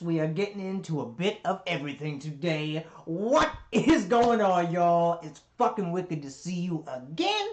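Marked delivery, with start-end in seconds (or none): 3.1-5.2 hype greeting. Real, not acted. Greeting viewers at the start.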